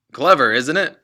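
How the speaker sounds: loud, smug car-dealer dad; crowing father, loud and pleased; boastful father. Acted. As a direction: brag to your son about your tricks. Father boasting to his son.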